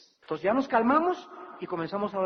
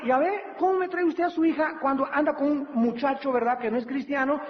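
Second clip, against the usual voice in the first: higher, reproachful rebuke to the mother; mild pastor reprimand; scolding pastor; 6 semitones higher than usual. Retold or imitated. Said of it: "le digo a su mamá".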